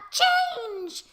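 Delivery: high, drawn-out mock command; mock yell. Quake yells out in the water, high mock yell. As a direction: call it out high, stretching the word. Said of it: "then he just yells out".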